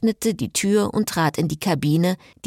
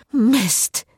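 groaning; 4 semitones higher than usual. A narrator's reading. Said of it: "stöhnte Cecile".

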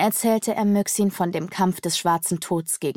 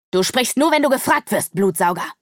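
higher, louder lashing command; whipping shout; 5 semitones higher, 5 dB louder than usual. A narrator's reading. Magician Myxin scolds the vampire bat, whipping shout. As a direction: crack it like a whip at the servant. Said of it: "schrie Myxin mit peitschender Stimme".

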